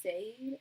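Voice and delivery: "[name]" as one syllable, SAYD, rising; childhood mispronunciation. Retold or imitated. Recalling her childhood misreading, "[name]" pronounced SAYD. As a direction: say "[name]" as spelt, rhyming with "made". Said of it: "I didn't know how to pronounce it".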